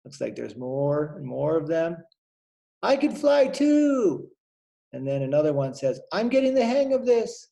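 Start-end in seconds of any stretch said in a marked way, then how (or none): 2.8-4.3 high, slow little bird voice; high chirpy swallow. Acted. Adult reading to a child, voicing a young swallow, high and slow. 6.1-7.5 much higher, proud little bird; high swallow voice. Acted. Reader voicing a swallow learning to fly, high.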